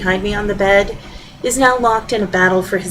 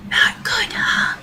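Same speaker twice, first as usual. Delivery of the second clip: hushed voiceless whisper; soft husband's whisper; 4 dB quieter, slower than usual. Acted. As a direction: breathe it as if not to disturb anyone. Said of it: "He's still whispering".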